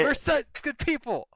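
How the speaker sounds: high, sing-song mock virtue; a high ironic pat on the back; mock self-righteous. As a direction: preen like a saint, ironically.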